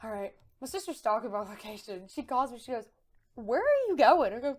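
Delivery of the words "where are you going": loud, higher mock demand; retold demanding question. Sister stalking her location, loud demanding question. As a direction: imitate a sister demanding an answer.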